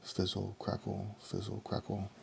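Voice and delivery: soft, hushed spell noises; hushed sound words. A narrator's reading. Narrator to children, softly voicing the spell. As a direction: murmur the magic crackling under your breath.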